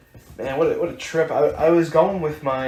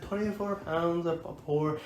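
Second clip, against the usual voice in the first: mock Scottish bartender; 5 semitones higher, 7 dB quieter than usual. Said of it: "and that Scottish bro".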